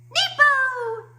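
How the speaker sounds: put-on high, loud baby shout; baby's cry. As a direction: squeal it like a toddler announcing herself. Baby Sunny calls out on stage.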